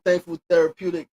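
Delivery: ordinary talk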